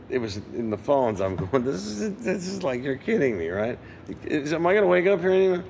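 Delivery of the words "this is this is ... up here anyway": higher and breathless, a bit faster at the end; can't believe it's happening, pitch jumping up; giddy disbelief. Retold or imitated. Session player jamming with [name], giddy and incredulous.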